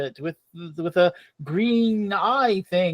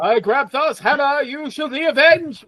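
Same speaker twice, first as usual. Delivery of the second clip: rising grand hero voice; heroic declaration; 7 semitones higher than usual. Retold or imitated. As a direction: proclaim it like a movie hero. Mock heroic avenging line.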